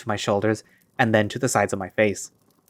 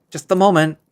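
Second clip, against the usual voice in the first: higher and louder, calling through a door; a raised call to someone outside; 7 semitones higher, 5 dB louder than usual. Acted. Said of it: "I yell this towards the door".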